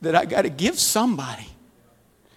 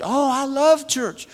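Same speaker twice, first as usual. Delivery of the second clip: put-on slow, higher voice for the girl; girl's happy answer; 10 semitones higher, slower than usual. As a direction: play the girl lighting up about church. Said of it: "girl answers in quote".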